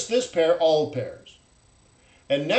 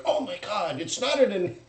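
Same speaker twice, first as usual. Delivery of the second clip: mock alarm; 5 semitones higher, 4 dB louder than usual. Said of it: "and you're like".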